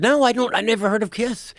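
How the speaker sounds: high-pitched mock protest; retold teasing denial. Guest teases the rock star, high mock denial. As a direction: pitch it right up, playfully insisting.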